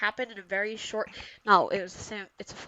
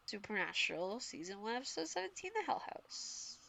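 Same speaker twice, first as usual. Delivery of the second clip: hushed title announcement; 12 dB quieter than usual. Pretend. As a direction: announce the episode title in a hush. Repeats the title as hushed teaser.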